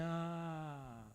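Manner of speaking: soft, deflated little ah. Retold or imitated. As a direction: let the air out, defeated. Reação murcha ao psicólogo. (um ah murcho e baixinho)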